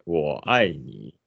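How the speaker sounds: slow, low, careful repetition; slow pronunciation. Shown as a demonstration. Presenter repeating after the Chinese teacher, slow careful pronunciation. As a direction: stretch each syllable as you copy the teacher. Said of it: "ゆっくり".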